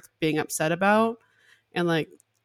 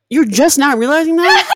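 much higher, louder disbelieving shout; incredulous; 9 semitones higher, 11 dB louder than usual. Retold or imitated. To her mom at breakfast, loud incredulous retort. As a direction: replay the shocked retort at full force. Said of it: "And I was like".

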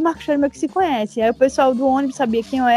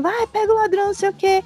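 higher-pitched mock call for help; retold calling out; 8 semitones higher than usual. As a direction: imitate calling out, without real volume. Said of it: "eu dizendo".

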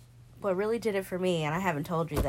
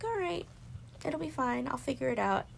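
high, breezy brush-off; high, breezy self-reassurance; breezy self-assurance; 7 semitones higher than usual. Retold or imitated. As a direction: chirp it off lightly, high. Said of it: "and I'm like".

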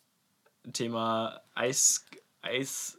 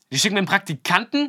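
much higher, louder outraged question; imitated freak-out; 8 semitones higher, 9 dB louder than usual. Rink owner blowing up about being sent an intern, mock outraged. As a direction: imitate him blowing up in disbelief. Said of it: "dann ist er so ausgerastet".

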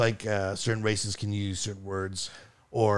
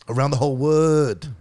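mock Chinese accent; 5 semitones higher than usual. Shown as a demonstration. Comic performing his Chinese idol joke, mock Chinese accent. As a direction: stretch it out in a broad put-on Chinese accent. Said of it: "I do the Chinese idol joke".

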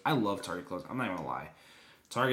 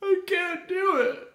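put-on whiny pleading kid; 7 dB louder, much slower than usual. Pleading to mom about pants.

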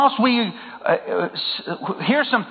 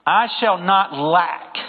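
slow emphasis; slower than usual. Shown as a demonstration. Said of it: "this is really important here".

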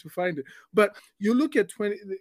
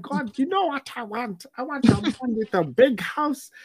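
high, eager wishful pleading; Nigerian woman praying; 7 semitones higher than usual. Retold or imitated. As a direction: reel off demands to God excitedly. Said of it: "so he has an accent, a Nigerian accent".

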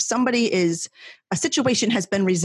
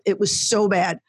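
ordinary delivery

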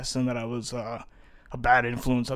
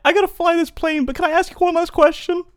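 squeaky high helium voice, louder; 8 dB louder than usual. Real, not acted. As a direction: keep the helium pitch up. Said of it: "I just hit a little helium".

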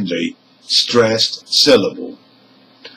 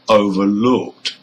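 "overlooked" with the second part, LOOKED, strongest; stress on LOOKED; 4 semitones lower than usual. Shown as a demonstration. Teacher gives compound verb number two, "overlooked" stressed on LOOKED. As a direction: keep over light and hit LOOKED in "overlooked". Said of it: "and looked in number two".

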